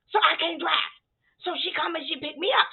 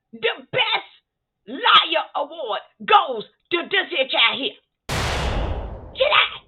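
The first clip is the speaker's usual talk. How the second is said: indignant rant; 4 semitones higher than usual. Real, not acted. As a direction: blow the whistle on the liar. Exposing the liar.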